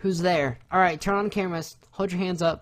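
playful horror-scene urgency; mock panicked questioning. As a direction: demand it like a spooked video-caller. Playing out an Unfriended scene.

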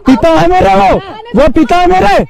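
real heated protest defending his father; angry insistence. Real, not acted. Heated roadside quarrel over insult.

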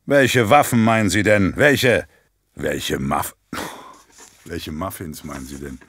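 usual speaking voice

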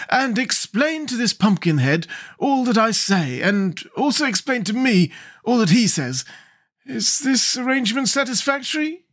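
high-pitched, courteous Scarecrow king. Acted. Scarecrow appoints the girl interpreter, high and courteous.

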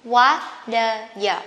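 "What the you" in separate syllables, the T as a soft D; careful broken-down "wha-duh-yuh". Shown as a demonstration. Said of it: "you can pronounce as the soft".

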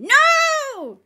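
shrill, piercing demon shriek; demon scream. A narrator's reading. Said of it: "thrashed and screamed".

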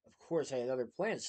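plain reading voice

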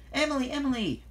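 high, insistent wake-up call; urgent calling. A narrator's reading. Thomas wakes sleeping Emily, urgent high call.

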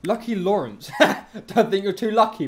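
ordinary talk, nothing marked

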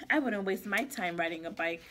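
snooty cat. Acted. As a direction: sniff at the idea like a bored cat. Cat's bored thought voiced.

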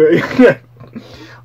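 loud laughing burst; burst of laughter. Real, not acted. Reviewer cracking up at the name Osama Twin Lava, loud laugh.

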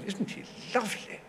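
high, soft, smitten society lady; Duchess of Devonshire. Retold or imitated. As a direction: coo softly, very high. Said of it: "she fell".

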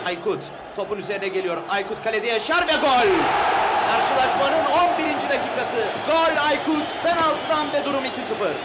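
rising excited goal call; live play-by-play. Acted. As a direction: build up to the goal shout.

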